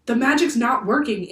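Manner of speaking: crying out. A narrator's reading. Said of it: "Annie cried to Jack".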